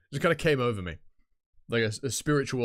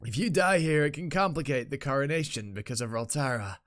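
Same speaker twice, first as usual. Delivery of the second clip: mildly scolding in-character warning; lightly voiced game character; 2 semitones higher than usual. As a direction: caution a reckless ally, lightly acted.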